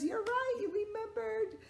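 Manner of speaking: high, bright praise; excited praise. Real, not acted. Teacher praising kids for the right answer. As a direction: cheer them for remembering.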